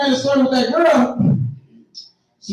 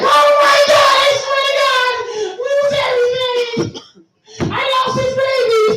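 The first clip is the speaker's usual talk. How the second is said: mock crying. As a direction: overdo the fake heartbroken crying of a girlfriend. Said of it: "they crying and shit".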